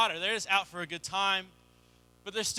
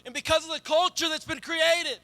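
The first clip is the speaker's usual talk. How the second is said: rising, louder preacher's push; preaching; 6 semitones higher, 4 dB louder than usual. Acted. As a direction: drive the point home to the congregation. Building sermon climax.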